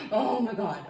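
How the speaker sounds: high laugh, then laughing words. Real, not acted. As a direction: crack up at yourself. Laughing at her own stutter.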